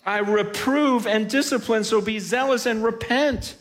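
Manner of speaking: high-pitched, urgent sermon delivery; impassioned preaching. Acted. Preacher rebuking the lukewarm church, impassioned. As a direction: preach it with rising fervor.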